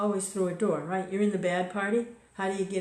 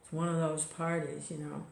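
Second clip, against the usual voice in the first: ordinary talk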